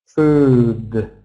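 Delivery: "food" with a long, held oo vowel; stretched oo sound. Shown as a demonstration. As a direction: draw out the oo in "food". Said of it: "Oo sound".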